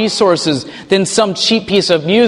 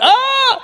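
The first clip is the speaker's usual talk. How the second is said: a short, very high mock shriek of panic; hammed-up crowd shriek; mock panicked scream. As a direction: squeal like a startled crowd. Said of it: "and everybody went".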